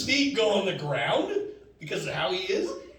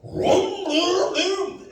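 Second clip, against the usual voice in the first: high mock motorcycle revving; engine vroom; 14 semitones higher, 4 dB louder, much slower than usual. Showing off to the ladies, high vocal engine revving. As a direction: rev the engine with your voice, high. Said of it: "I'm like".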